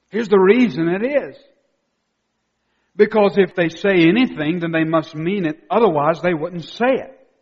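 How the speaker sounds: loud, insistent sermon delivery; emphatic preaching. Real, not acted. Preacher giving the congregation the reason, emphatic and loud. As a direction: drive the reasoning home loudly.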